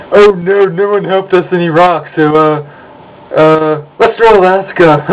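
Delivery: high, loud whiny parody voice; mocking whine. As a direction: ham up a whining patriot. Mocking patriots' whiny logic.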